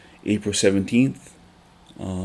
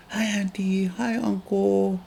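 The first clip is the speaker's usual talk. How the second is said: put-on much higher toddler voice calling out, slow on the second greeting; little son calling out; 12 semitones higher, 3 dB quieter, slower than usual. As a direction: call out eagerly like a small child greeting strangers. Son yelling to passing strangers.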